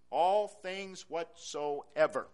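"All things whatsoever" very slow and weighty; slow stress. Shown as a demonstration. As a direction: let "All things whatsoever" sink in slowly. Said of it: "He added emphasis".

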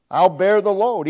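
ordinary talk

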